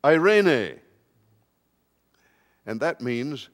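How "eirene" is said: "Eirene" proclaimed higher and much louder, ay-REH-neh; Greek word said. Shown as a demonstration. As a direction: ring out "Eirene" as the key word. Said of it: "you would see that Jesus says".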